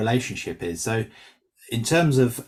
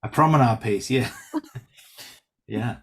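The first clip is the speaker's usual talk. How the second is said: echoing the phrase through a laugh; 4 dB louder than usual. Real, not acted. In a writing group, amused at a colleague's suggestion, laughing. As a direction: chuckle while repeating it back.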